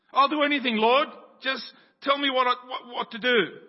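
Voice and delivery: loud, high, rushed pleading; desperate begging. Acted. Jack on the branch, begging God for instructions. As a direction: beg frantically for instructions.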